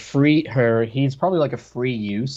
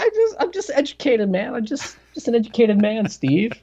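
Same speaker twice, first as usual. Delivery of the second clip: high, laughing self-mockery; 12 semitones higher than usual. Real, not acted. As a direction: giggle through the mock boast. Laughing at his own joke.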